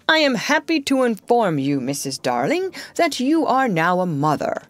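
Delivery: play-acting a proud father; imitating father's formal tone. John to his mother playing at father, mock pompous. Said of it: "in just such a tone as Mister Darling himself".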